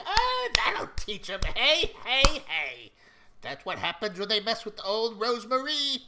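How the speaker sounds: high, loud cackly glee; gleeful Rosemarie. Acted. Shopkeeper gloating over the chased-off toughs, gleeful crowing. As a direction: crow in triumph in a high-pitched character voice. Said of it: "she's clapping with glee".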